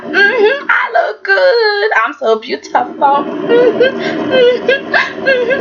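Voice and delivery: high, self-admiring croon; mock vain purring with drawn-out praise; feeling herself. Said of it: "that's what she's thinking".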